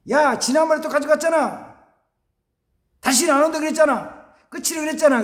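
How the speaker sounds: fed-up parent complaint; exasperated father. Retold or imitated. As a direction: scold the son who keeps borrowing. Father refusing repeated request.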